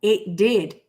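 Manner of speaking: usual speaking voice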